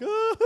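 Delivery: high mock cry of dread; short high wail, as if peering over an edge; frightened cry. Man afraid of heights feeling the drop, mock frightened cry.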